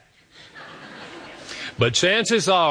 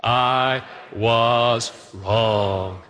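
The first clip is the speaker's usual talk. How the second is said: drawn out, each word weighed; slow earnest I was wrong; slow deliberate confession; 3 semitones lower, much slower than usual. Shown as a demonstration. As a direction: say it slowly, like it costs you. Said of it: "Let's just say it out loud together".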